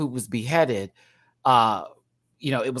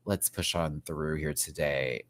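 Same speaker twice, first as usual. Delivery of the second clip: ordinary talk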